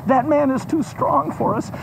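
high conspiratorial plotting; scheming dragon mother. Acted. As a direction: urge your son to kill him. Mother plots the killing.

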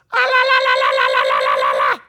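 high-pitched ululating mourners' wail, hammed up; mock mourner wail. Preacher imitates professional mourners' high wailing cry. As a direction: wail "la la la" like paid mourners, full voice. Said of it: "screaming in as high a pitch as they could".

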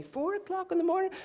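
high-pitched disbelief; incredulous. Real, not acted. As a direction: pitch leaps up in astonishment at the early hour. Incredulous at friend's prayer hour.

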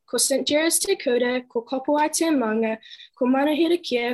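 ordinary talk, nothing marked

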